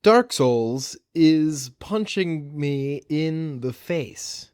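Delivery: ordinary talk, nothing marked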